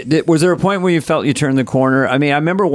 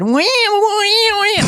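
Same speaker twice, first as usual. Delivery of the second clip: high squealing wee wee wee; squealing dog noise; much slower than usual. Acted. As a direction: squeal it like an excited dog, stretched out. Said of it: "It always goes in voices".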